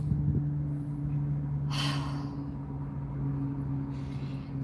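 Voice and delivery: long, drawn-out exasperated sigh; smart aleck sigh. Retold or imitated. Vlogger mocking a nosy driver with a long, exasperated sigh. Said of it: "smart aleck and be like".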